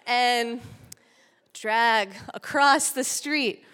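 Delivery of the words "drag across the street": ordinary talk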